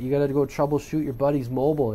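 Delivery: ordinary talk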